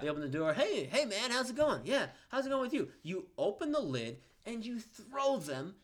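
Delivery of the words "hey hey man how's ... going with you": higher, breezy fake friendliness; mock friendly greeting. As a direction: greet them innocently before the prank.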